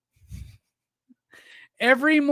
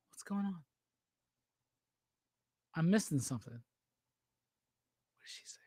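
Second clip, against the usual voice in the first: a soft unvoiced whisper to himself; puzzled whisper at the chat; whispered aside; 5 semitones lower, 13 dB quieter, faster than usual. Real, not acted. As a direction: whisper it, puzzled, under your breath. Confused about chat comment.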